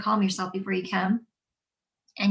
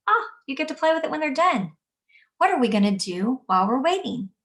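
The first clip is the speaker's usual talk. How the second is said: upbeat; 5 semitones higher, 4 dB louder than usual. Shown as a demonstration. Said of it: "use that calm voice".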